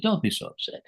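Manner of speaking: lightly imitated, high and easy; high airy reassurance. Dying friend telling his partner not to worry, high and airy. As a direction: soothe her like it's trivial.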